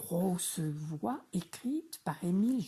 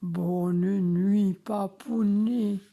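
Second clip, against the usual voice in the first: much louder big-voice shout; 9 dB louder, slower than usual. Acted. Three little frogs yell good night to dad, big-voice shout. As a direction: shout it proudly in your biggest voice. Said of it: "crient d'une grosse voix".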